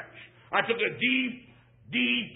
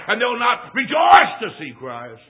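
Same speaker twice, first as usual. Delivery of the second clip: preaching shout; 4 semitones higher, 9 dB louder than usual. Acted. Preacher building a warning.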